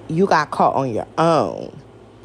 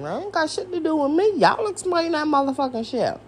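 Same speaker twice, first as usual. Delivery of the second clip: normal talking voice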